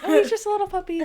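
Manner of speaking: put-on sweet, high little voice; sweet little girl. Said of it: "and she had a nice, sweet voice".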